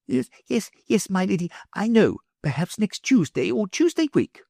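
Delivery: put-on high, eager old scholar; meek professor. A narrator's reading. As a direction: hurry to please her. Professor voiced by reader.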